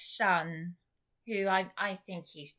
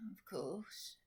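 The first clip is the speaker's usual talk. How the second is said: whispering; 13 dB quieter than usual. Real, not acted. Whispered aside.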